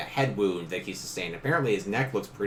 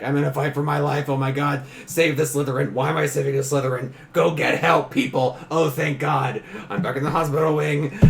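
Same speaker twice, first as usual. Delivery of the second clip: higher, louder, fast frantic rush; panicked inner monologue; 5 semitones higher, 5 dB louder than usual. Retold or imitated. Game master retells the character's frantic thoughts, higher and louder. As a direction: race through the panic breathlessly.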